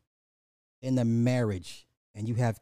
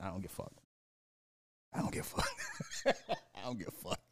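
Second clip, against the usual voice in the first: fading repeated mutter; 9 dB quieter, much faster than usual. Real, not acted. Repeating, trailing off.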